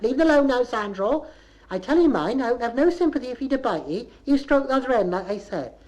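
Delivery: high, loud telling-off; scolding. Acted. Woman interrupts her doctor story to warn Sandro, high scolding.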